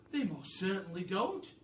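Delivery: a high, loud monkey cry; a monkey agreeing with a cry. Acted. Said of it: "cried Mugglewump".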